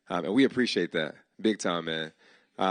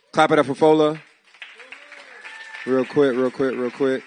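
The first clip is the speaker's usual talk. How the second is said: loud and higher, rallying the room; calling out to the crowd; 4 semitones higher, 9 dB louder than usual. Real, not acted. Host rallying the audience to clap for Fola, loud call.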